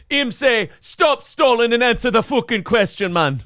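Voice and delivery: put-on high Jamaican accent drifting into Irish; Jamaican slipping Irish. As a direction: let the comic Jamaican slide toward Irish. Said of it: "slipping into Irish for Braveheart".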